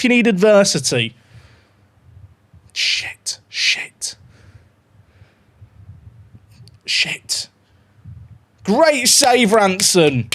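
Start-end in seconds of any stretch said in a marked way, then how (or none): 2.7-7.5 under-the-breath whispered swear; whispered shit on a near miss; whispered curse. Real, not acted. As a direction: hiss it to yourself. Conceding chance in match.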